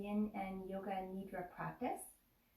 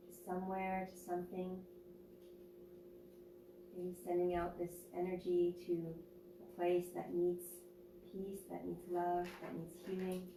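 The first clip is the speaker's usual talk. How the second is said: guided meditation; 4 dB quieter than usual. Acted. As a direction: ease them into stillness, unhurried.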